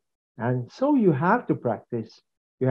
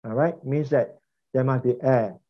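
ordinary talk

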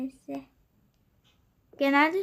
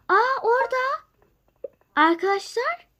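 higher, louder excited cry; excited discovery; 9 semitones higher, 6 dB louder than usual. Real, not acted. Spotting the lost child, calling out excitedly to viewers. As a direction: burst out at finding them.

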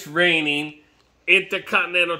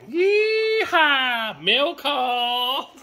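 a whooping catchphrase call; excited shout; 9 semitones higher, much slower than usual. Real, not acted. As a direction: holler it like a cowboy.

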